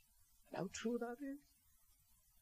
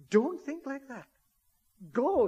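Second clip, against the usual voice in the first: louder, higher voice of the psalmist urging go; urging command; 8 semitones higher, 8 dB louder than usual. Acted. Psalmist telling the downcast to go to God's house, urgent. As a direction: push them through the door. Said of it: "This man says".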